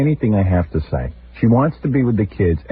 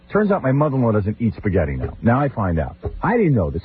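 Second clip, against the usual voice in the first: usual speaking voice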